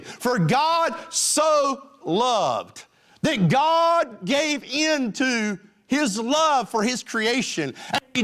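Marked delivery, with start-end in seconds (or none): 0.0-6.8 "so" stretched out very slow and raised; slow preaching. Acted. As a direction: draw every word out, higher. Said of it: "Jesus is saying".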